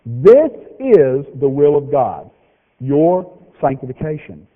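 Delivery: very slow, weighty scripture reading; read slow again. Shown as a demonstration. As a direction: lay each word down slowly so it sinks in. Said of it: "Here it is again".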